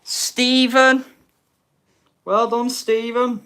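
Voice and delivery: loud high call of the winner; excited announcement. Real, not acted.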